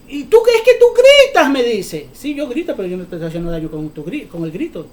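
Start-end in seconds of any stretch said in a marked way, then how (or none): 0.1-1.5 reproche imitado en voz alta y aguda. Retold or imitated. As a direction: imita a quien te reclama, subiendo la voz. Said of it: "alguien me dice". (reproche imitado a gritos)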